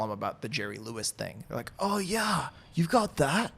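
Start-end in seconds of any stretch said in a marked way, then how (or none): 1.8-3.5 put-on high-pitched, drawn-out older listener; mock older person reacting, pitched way up; older person impression. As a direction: put on a high, surprised elderly voice. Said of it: "my impression of an older person".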